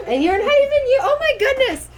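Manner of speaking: high and loud through laughter; laughing. Real, not acted. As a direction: burst out laughing mid-sentence. Laughs at man mopping.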